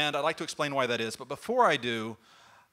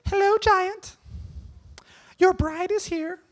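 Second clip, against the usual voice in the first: much higher, slow dainty lady voice; falsetto bridesmaid; 5 dB louder, slower than usual. Acted. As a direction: play the sweet bridesmaid. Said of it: "dressed as the bridesmaid".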